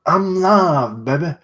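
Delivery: put-on high, loud "I'm live, baby" like a hype streamer; goofy hype voice.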